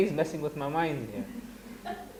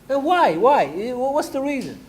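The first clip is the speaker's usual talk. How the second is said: high, louder pressing questions; retold puzzled demand, raised pitch; mock exasperated why; 9 semitones higher, 4 dB louder than usual. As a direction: push them for the reason, incredulous. Pressing students on why they cry.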